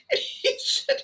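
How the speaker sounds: giggling through the words; can't hold back the laugh. Real, not acted. The speaker laughing as she tells the staple story.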